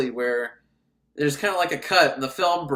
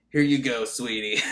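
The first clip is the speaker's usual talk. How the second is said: lightly voiced low-pitched shop helper; deep voice; 5 semitones lower than usual. Tall shop helper serving a customer, lightly mocked deep voice. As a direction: drop a little into a mock deep voice. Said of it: "and a deep voice".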